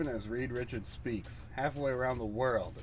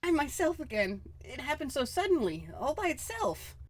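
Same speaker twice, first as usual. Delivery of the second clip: put-on higher, alarmed young woman's voice; frightened Susan; 12 semitones higher than usual. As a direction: play Susan panicking as she fades away.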